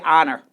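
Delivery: "honor" with a silent H; honor without the H. Shown as a demonstration. Co-host giving honor as her example, H silent. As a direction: start "honor" on the open vowel. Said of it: "I say without the H".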